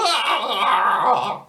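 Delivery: a high startled scream of fright; frightened yell. A narrator's reading. Boy screaming at the looming figure, a startled yell. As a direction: scream as the monk looms.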